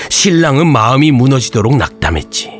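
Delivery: ordinary delivery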